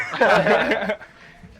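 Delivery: loud, high burst of laughter; real laugh. Real, not acted. Laughs after quoting the cop.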